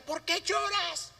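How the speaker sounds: a mother barking at her crying baby, a mild mock yell; mock scolding yell. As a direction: play an impatient mother snapping at the baby. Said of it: "se pare y le grita".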